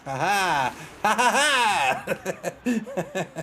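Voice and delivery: big theatrical ha-ha; stagey cackling "ha ha ha"; hammed-up laugh. Mock laugh at rats joke.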